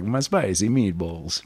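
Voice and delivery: slow, hammed-up Italian accent; jokey, badly faked Italian accent; caricature Italian accent. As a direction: ham it up as a cartoon Italian. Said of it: "I can't even do his accent. All I wanna do is like".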